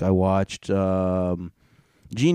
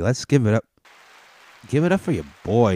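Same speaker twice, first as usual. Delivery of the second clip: excited; 3 semitones higher, 4 dB louder than usual. Real, not acted. Hyping up viewers.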